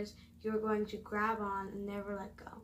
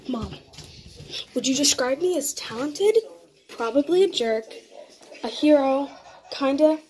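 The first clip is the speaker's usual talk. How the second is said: much louder, shouting across the house; calling out loud; 13 dB louder than usual. Real, not acted. Girl shouting quiz answers to her mom in another room, calling out loudly.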